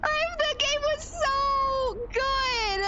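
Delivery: high, slow wailing lament; anguished whine. Real, not acted. Mourning the great match lost to Noed, wailing. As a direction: stretch it out like a mock cry.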